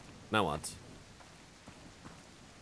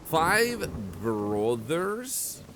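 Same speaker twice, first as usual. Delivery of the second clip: slow, drawn-out disbelieving question; incredulous; 2 semitones higher, much slower than usual. Real, not acted. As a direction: stretch it out in disbelief. Baffled at the five brothers.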